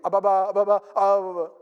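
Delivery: mock stammer. Impostor uncle stammers when confronted.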